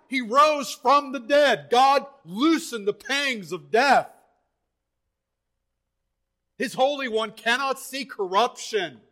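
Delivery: forceful preaching, weighting every word. Real, not acted. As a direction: hammer each phrase home from the pulpit.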